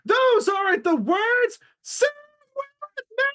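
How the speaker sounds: piercing, very high shriek; screaming cartoon mouse. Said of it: "she starts screaming so loud".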